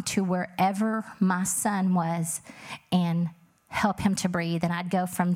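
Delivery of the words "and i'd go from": ordinary delivery, nothing marked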